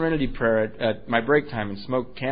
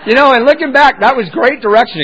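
laughing; 9 semitones higher, 12 dB louder than usual. Real, not acted. Laughing while recalling.

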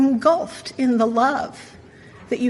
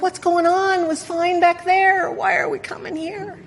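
lightly voiced confused dog, pitched higher; voicing the dog; 7 semitones higher than usual. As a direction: give the puzzled dog a high, questioning voice. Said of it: "The dog is like".

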